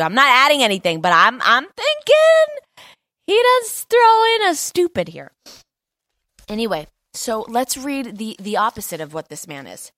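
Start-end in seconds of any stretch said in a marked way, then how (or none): 0.0-5.3 joking insistence. Real, not acted. Preacher defending his joke about Jesus, squealed high insistence. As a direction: climb into a loud, high squeal.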